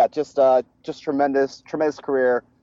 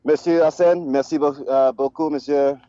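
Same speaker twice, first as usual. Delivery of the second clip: raised, halting French words; French accent; 4 semitones higher than usual. Pretend. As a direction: stumble through the French farewell. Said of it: "are you choking on the French".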